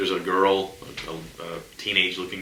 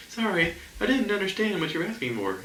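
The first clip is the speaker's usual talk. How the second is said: much higher robotic assistant voice; Alexa voice; 12 semitones higher than usual. Retold or imitated. Mocking the Alexa on the table, high robotic voice. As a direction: play the smart speaker apologising. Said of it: "my stupid little Alexa".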